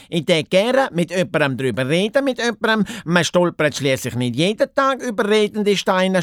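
high, babbling hare voice; flustered excited hare. Acted. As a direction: ramble on and stumble over the words. (ufgregt plappernde Haas)

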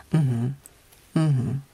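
low, relaxed hum of acknowledgement; relatively low mhm. Shown as a demonstration. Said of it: "so dieses relativ tiefe".

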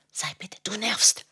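whispered, irritated hush; annoyed whisper. Real, not acted. Mother whispers at her interrupting child, annoyed.

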